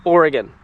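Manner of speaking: "Oregon" with a weak gun ending, falling; correct local pronunciation. Shown as a demonstration. Vlogger repeating the right way for viewers, "Oregon" ending in unstressed gun. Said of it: "this word here is pronounced".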